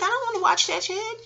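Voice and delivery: high-pitched protest. Retold or imitated. Telling viewers how she refused the show, high-pitched protest. Acting out her past reluctance.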